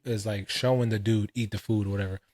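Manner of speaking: usual speaking voice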